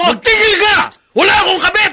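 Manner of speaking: husband yelling back at his accusing wife, furious; angry denial. Acted. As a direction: shout her down, stung. Husband denying affair, shouting.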